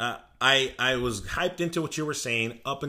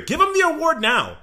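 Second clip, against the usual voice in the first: much higher, louder hype shout; excited fan shout; 10 semitones higher, 7 dB louder than usual. Real, not acted. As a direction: demand the award at full volume. Fan hyping his player.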